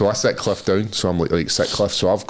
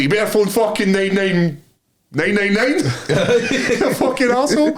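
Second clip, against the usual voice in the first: higher, a hammed-up yell that collapses into laughter; mock angry retort; 11 semitones higher than usual. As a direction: shout back, then crack up on the insult. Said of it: "I was like".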